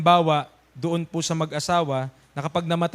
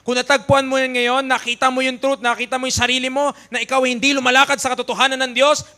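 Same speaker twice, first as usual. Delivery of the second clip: louder, higher preaching, fast at first; rising preaching fervour; 6 semitones higher, 5 dB louder than usual. Acted. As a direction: drive the call to repent home. Preaching builds again. (masigabong pangangaral)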